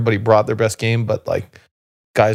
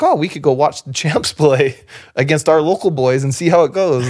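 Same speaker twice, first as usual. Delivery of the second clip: higher-pitched imitation of keen local fans; mock eagerness; 5 semitones higher than usual. Townspeople keen to watch champs play local boys, mock eagerness. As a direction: pitch it up like townsfolk planning an outing.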